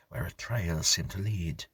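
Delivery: plain reading voice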